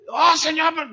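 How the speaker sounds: loud, high pleading outburst; exasperated protest. Retold or imitated. Pastor protests to the Lord, loud exasperated plea.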